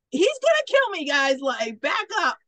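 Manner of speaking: high, loud panicked yelp; mock terrified yell for help; panicked shout. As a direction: squeal it out like you're about to be shot. Cowboy panics with gun to head.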